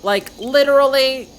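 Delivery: high, slow, loud "Like, literally"; squeaky, drawn-out "literally"; emphatic. Acted. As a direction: stretch and lift "literally" for drama. Valley girl stresses her point.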